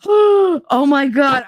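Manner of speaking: high, slow, gasping "oh my God"; breathless with sudden recognition; mother's delighted gasp. Retold or imitated. Her mother, finally getting the name, gasps high and slow. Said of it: "she was like".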